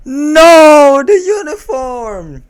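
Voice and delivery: long, high, loud "no" of dismay; hammy high-pitched cry of protest; dismayed scream. Real, not acted. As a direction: wail it out in mock horror, drawn out. Outraged reaction to the story.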